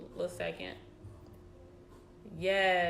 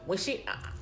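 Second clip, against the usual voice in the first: ordinary delivery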